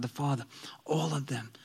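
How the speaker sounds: soft unvoiced whisper. Real, not acted. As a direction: hush it for the room. Hushed sermon point.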